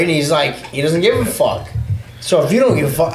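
usual speaking voice

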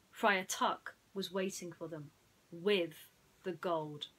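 plain reading voice

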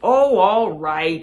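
giving in. Acted. Rabbit gives in happily.

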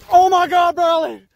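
screaming in disbelief at the catch; excited yell. Real, not acted. Angler yelling to Bradley over a giant bass, excited shout. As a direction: shout it out, high and loud, thrilled. Huge fish landed, yells friend's name.

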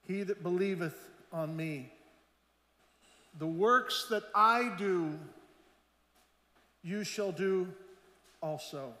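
measured pulpit reading of scripture. Acted. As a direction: read the verse like holy writ. Reads scripture aloud.

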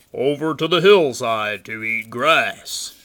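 loud, booming goat voice; big goat's reply. A narrator's reading. Great Big Billy tells the troll his plan, booming. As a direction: answer boldly at full volume.

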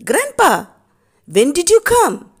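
put-on high, loud surprised child's voice. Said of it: "Arivumati was surprised".

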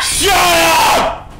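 high, sudden yell; shouting. Real, not acted. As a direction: yell it at the screen. Yelling at game.

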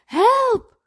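high, loud shout; frightened child's call; crying for help. A narrator's reading. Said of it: "roept hij".

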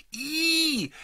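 high squeak of disgust; squeal. A narrator's reading. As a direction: squeal like a scared little girl. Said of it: "quietscht Lena".

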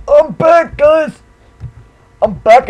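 high, loud hype; excited shout. Real, not acted. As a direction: burst back in, hyped up. Returning to video, hyped.

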